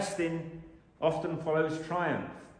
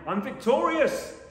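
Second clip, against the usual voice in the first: mock triumphant shout, higher and louder; fake cheer; 9 semitones higher, 7 dB louder than usual. Preacher mocking forced happiness, hammed-up cheer. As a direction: ham up a forced happy victory cry. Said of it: "put on a smile and pretend I'm happy".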